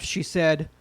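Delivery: normal talking voice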